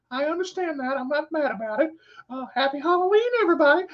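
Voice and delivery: put-on high creepy old man voice; Herbert. Creepy old man excusing a refused popsicle, high put-on voice. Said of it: "from a creepy old man named Herbert".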